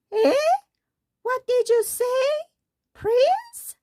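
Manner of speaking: very high, loud crone's croak; croaking old witch. Acted. Old witch to the prince, croaking and very high. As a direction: screech it like a deaf old hag. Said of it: "croaked the witch".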